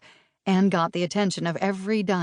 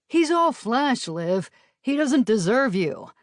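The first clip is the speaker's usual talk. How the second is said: dismissive plea; 6 semitones higher, slower than usual. Acted. Bentley pleading against Guy.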